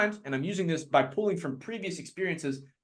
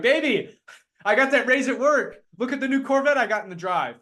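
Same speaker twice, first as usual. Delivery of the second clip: excited bragging spouse; 7 semitones higher, 3 dB louder than usual. Retold or imitated. Spouse bragging about new car.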